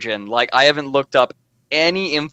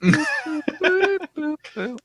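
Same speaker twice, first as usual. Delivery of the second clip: high, short boop noises; tiny high-pitched boop, boop, boop; playful boops; 5 dB quieter, much slower than usual. Pretend. Filling the silence with noises, playful boops.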